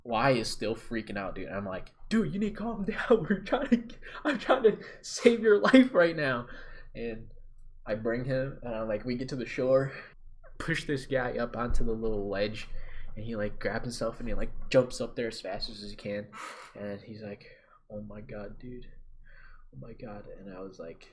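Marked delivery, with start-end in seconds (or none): 2.1-6.5 high, loud, strained plea; acted-out shout at a panicking friend mid-rescue; frantic yelling. 17.9-20.2 hushed, drained retold reaction; quiet, stunned murmur; shaken relief. Rescued friend's shaken reaction.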